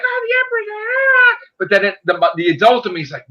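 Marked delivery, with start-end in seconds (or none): 0.0-1.4 put-on squealing high with childlike glee; little kid excitement. Fan recalling his inner child's thrill, squealing high. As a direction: gush like a kid who just saw the Emperor return. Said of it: "the little kid in me is like".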